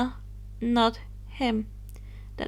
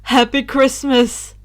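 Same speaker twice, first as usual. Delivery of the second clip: slow, louder call; 9 dB louder than usual. Acted. Calling goodbye to the Dementors, slow and louder. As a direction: call it out across the yard. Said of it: "he called to them".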